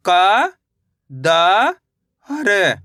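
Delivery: each letter drawn out, with a long pause after it; stretched, separated syllables. Shown as a demonstration. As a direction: sound out the letters one at a time for learners. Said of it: "मेरे साथ साथ इनको repeat".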